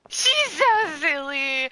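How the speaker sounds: drawn-out loud emotional gush; loud slow gush. Real, not acted. Streamer overcome by a cute character, slow loud gush. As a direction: wail it out, overwhelmed by cuteness.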